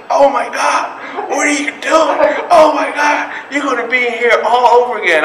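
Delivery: high, loud drill instructor outrage; imitated instructor losing it; appalled shouting. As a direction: shriek at the recruit's blunder. Instructor appalled at muddy weapon.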